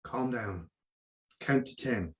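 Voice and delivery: calming the wife. Retold or imitated. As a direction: settle her down, hush it gently. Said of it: "so I say to my wife".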